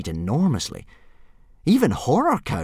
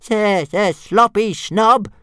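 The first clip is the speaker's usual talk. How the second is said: much higher, louder, slow stutter; stammering dragon taunt; 12 semitones higher, 5 dB louder, slower than usual. Acted. Toothless jeering at Fireworm from the air, high stutter. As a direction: call the insult out in a squeaky stammer. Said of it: "Toothless called out".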